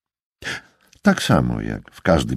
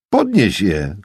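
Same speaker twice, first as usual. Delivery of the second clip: a mildly raised, higher call; 5 semitones higher, 3 dB louder, slower than usual. A narrator's reading. Buddy scolding Tyrell over dropped cards, calling out. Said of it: "zawołał".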